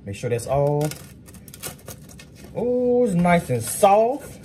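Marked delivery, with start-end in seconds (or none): 2.6-4.4 delighted. Real, not acted. Feeling the soft food.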